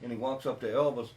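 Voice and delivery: ordinary talk, nothing marked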